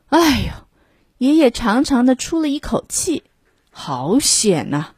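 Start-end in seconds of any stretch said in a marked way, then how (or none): none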